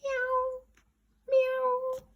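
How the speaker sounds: very high meowing cat; cat meows. Acted. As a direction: mew like a cat. Cats in courtyard.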